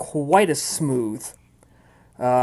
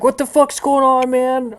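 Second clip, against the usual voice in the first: high-pitched, whiny put-on voice; rudely woken sleepers; 11 semitones higher than usual. Game master as street people woken up, high complaining voice. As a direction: complain as if just jolted from sleep. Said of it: "the people are waking up like".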